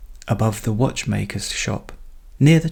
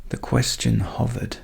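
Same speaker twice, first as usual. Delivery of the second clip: plain reading voice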